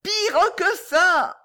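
high, slow, stretched-out yell; shouting. A narrator's reading. Boy answering the deaf old lady, shouting. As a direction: cry it out, dragging every word. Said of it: "cria le jeune homme dans l'oreille".

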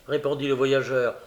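usual speaking voice

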